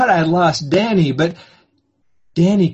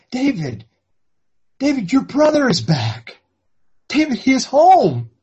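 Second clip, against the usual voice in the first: higher, eager call across the room; excited calling out; 10 semitones higher, 3 dB louder than usual. Acted. Father calls to elder son, excited. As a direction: shout the good news to him.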